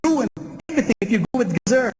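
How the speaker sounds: near shout. Acted. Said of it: "This time Joseph almost shouts".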